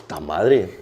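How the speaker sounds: sharp loud sigh then a tearful curse; real tears spilling over; crying outburst. Real, not acted. Guest pausing his story, crying out a curse in Spanish. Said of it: "Give me, like, two seconds".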